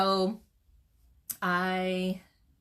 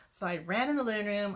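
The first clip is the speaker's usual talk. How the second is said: ordinary talk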